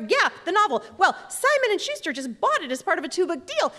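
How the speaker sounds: high-pitched, punchy retold announcement; excited bragging. Daughter tells mother about the book deal, excited. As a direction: proudly show off, pitch lifted.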